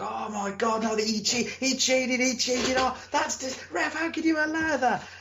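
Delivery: high, pleading crowd outcry; outraged crowd. Retold or imitated. Wrestler mimics crowd protesting the cheat, high outraged whine. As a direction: whine like a cheated fan begging the ref. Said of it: "crowd voice, it's like".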